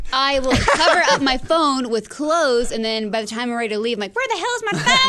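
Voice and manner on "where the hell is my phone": high, frantic outburst. Retold or imitated. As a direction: panic about the lost phone. Frantic search for lost phone.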